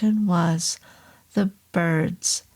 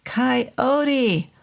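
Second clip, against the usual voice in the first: a high held call of the name; bellowing; 5 semitones higher than usual. Acted. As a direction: bellow it up at the bridge. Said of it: "I bellowed".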